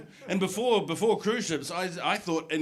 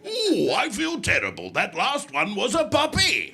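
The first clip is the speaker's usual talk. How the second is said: mock regret; 5 semitones higher, 7 dB louder than usual.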